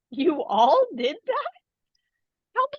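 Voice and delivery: slow, disbelieving friend's question; retold incredulous friend. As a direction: drag it out in disbelief. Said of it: "he was just like".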